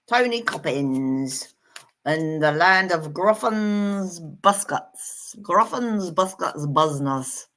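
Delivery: slow, drawn-out mock New Zealand accent; jokey New Zealand accent at a leisurely pace. Shown as a demonstration. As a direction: put on a playful New Zealand accent, stretching the words. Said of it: "That's my New Zealand accent".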